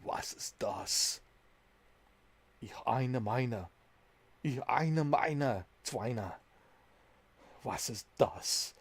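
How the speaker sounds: slow, hushed fake German accent; hammed-up German dub voice; mock German. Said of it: "like, John Wick in German?".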